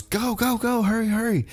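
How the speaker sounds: high squeaky frantic urging; Carmella voice. Retold or imitated. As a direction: pitch way up as an excited Carmella.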